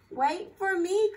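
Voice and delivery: loud, high call to the others; crying out. Acted. Mouse calls after her friends, loud high cry. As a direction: call after them not to be left behind. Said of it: "cried Mouse".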